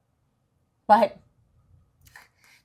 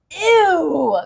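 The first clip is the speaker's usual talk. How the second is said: high, loud, grossed-out ew; disgusted ew; 8 semitones higher, 8 dB louder than usual. Acted. Lucy disgusted.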